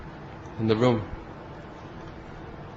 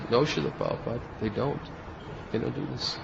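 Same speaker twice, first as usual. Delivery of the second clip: softer, timid imitated answer; meek reply; 10 dB quieter than usual. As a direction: shrink back and answer the master quietly. Disciple answering scolding guru meekly.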